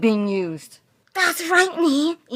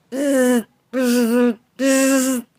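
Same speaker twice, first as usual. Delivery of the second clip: ordinary delivery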